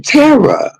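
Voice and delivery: calling his name very high; mock shocked cry. She cries out to her boyfriend, shocked. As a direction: gasp out his name in disbelief. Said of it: "And I was like".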